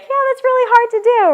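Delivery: high lightly voiced child; kid voice. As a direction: play a candid kid. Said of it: "most kids have enough awareness to be like".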